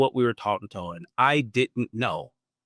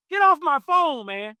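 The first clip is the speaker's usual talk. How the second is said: much higher, loud exasperated brush-off; uncle hanging up; 13 semitones higher, 4 dB louder than usual. Retold or imitated. Uncle dismissing his nephew on the phone, high and loud. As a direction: hang up on him in mock disgust. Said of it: "He hung up on me".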